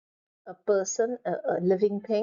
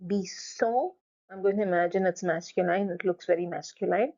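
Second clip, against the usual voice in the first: ordinary talk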